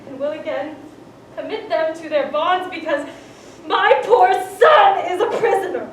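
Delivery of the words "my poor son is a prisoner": loud, cracking cry of grief; breaking lament. Acted. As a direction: let the voice break on the son. Constance grieving her son.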